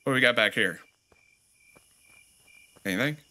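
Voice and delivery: normal talking voice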